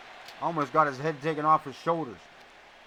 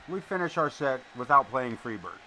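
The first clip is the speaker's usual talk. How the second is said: normal talking voice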